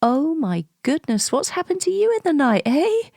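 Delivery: high, loud mock alarm, rushed; cheery exclamation. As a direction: exclaim brightly to soothe her. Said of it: "I say things like".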